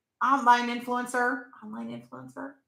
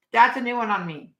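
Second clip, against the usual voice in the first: normal talking voice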